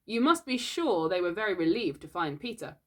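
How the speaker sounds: plain reading voice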